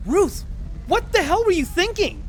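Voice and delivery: high, loud angry outburst; angry scolding. Acted. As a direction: yell at her in relieved fury. Scolding Ruth after the fire.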